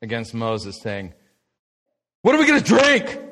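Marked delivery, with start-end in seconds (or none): 2.2-3.2 high, loud whiny complaint; grumbling complaint. Retold or imitated. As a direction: whine like a thirsty crowd. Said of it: "the people grumbled".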